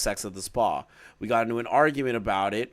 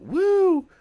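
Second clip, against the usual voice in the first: a much higher sarcastic woo; mock celebration whoop; jokey cheer; 4 dB louder than usual. Real, not acted. Mock cheer reading message.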